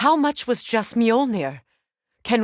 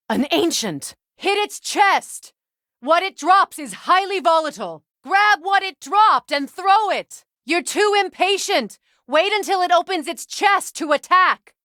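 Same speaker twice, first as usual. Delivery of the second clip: shouted battle callouts; 8 semitones higher than usual. Acted. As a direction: yell the tactics over the clash of the fight. Combat callouts to Kratos mid-fight.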